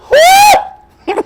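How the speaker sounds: a high, loud whoop of disbelief; excited whoop. Real, not acted. Whooping to the audience about being cuffed, high and loud. Furious, exclaiming at the memory.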